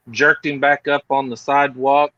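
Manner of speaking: ordinary talk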